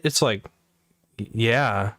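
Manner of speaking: ordinary talk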